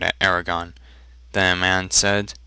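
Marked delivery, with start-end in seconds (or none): none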